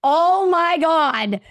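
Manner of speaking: high, long-drawn dismay; retold dread. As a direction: stretch each word in dramatic shock. Rival back, limbo is called.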